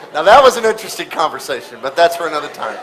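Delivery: a real chuckle in the words; laughing. Real, not acted. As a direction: laugh while brushing it aside. Laughs through the aside.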